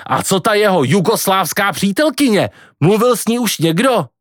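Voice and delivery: high-pitched irritated questioning; cursing, exasperated. Acted. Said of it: "nadával Kvido". (podrážděně, vysokým hlasem)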